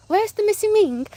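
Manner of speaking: high, haughty Alexis voice, British-tinged; mock the grand soap diva demanding her son; campy high diva impression; imitating Alexis. Alexis meeting Crystal.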